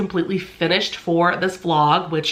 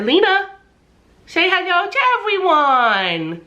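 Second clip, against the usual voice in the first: talking to a dog; 11 semitones higher than usual. Real, not acted. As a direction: coo up to the little dog. Calling to the dog on camera.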